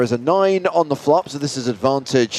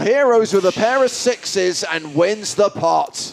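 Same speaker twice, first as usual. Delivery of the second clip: excited; 9 semitones higher than usual. Real, not acted. Commentator calls a big call.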